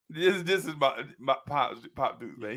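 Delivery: ordinary talk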